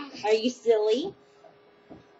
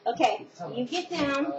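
usual speaking voice

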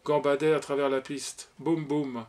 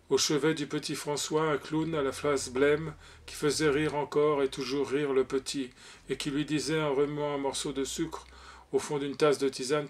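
ordinary delivery

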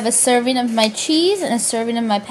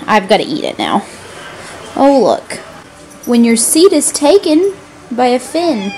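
ordinary talk

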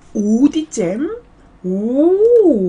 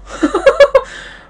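squealing laugh; 8 dB louder than usual. Real, not acted. Laughing at cute dog.

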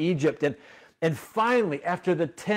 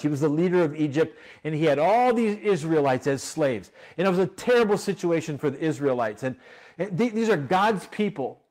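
ordinary talk

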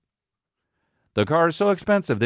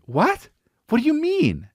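high, sharp mimic of the wife; wife acting puzzled, mimicked in a high voice; high mock wife; 10 semitones higher than usual. Wife's reaction mimicked.

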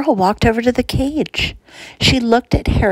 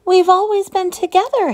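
high, howling lament; 11 semitones higher than usual. A narrator's reading. Hamster howls to her sister that they belong together. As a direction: howl it to the rafters in grief. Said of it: "Harriet howled".

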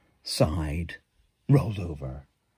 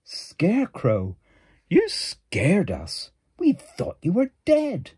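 slow louder worried cry; relieved animals; 10 semitones higher, 8 dB louder, slower than usual. Acted. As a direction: call out in relief.

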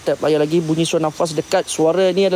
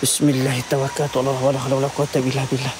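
murmured prayer; 4 semitones lower, 3 dB quieter, faster than usual. Real, not acted.

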